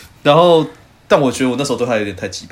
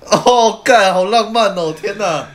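gushing; 9 semitones higher, 3 dB louder, slower than usual. Real, not acted. Friend gushes at romantic kiss story.

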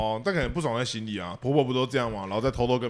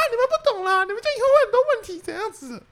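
much higher, fast scolding mom voice; mother scolding; 4 dB louder than usual. Retold or imitated. Imitating her mother scolding the couple, high and fast. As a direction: ham up an old-fashioned mother lecturing. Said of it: "然后就会说什么".

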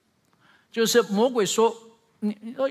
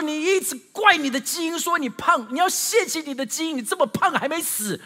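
higher, louder mock scolding from a fed-up dietitian; retold angry scolding; 9 semitones higher, 4 dB louder than usual. Fed-up dietitian scolding people who blame genes, half-mocking angry rant. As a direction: scold them as an irritated nutritionist would. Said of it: "营养师就很不爽的就骂".

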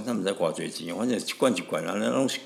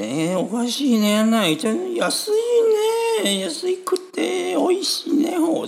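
much higher, gushing Japanese exclamations; gushing Japanese tourist; 5 dB louder than usual. Retold or imitated. Radio host mimicking delighted tourists, in high gushing Japanese. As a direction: squeal over cheap tasty food in Japanese. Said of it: "就讲哎".

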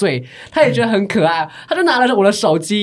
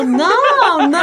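put-on drawn-out drunk no; 7 semitones higher, 3 dB louder than usual. Drunk friend refusing, retold.

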